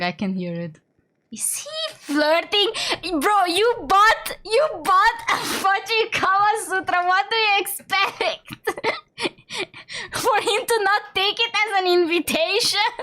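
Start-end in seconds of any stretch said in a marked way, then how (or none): 1.3-10.1 high, drawn-out incredulous squeal; giddy squealing. Real, not acted. Squealing over flirting game character. 10.1-12.9 high-pitched, laughing incredulity; giddy disbelief. Real, not acted.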